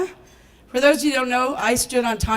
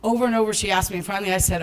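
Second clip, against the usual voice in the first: ordinary talk, nothing marked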